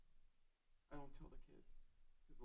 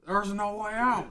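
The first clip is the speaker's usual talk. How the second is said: loud, high, slow despairing wail; a desperate shout of horror; 1 panicked cry; 9 semitones higher, 21 dB louder, slower than usual. Acted.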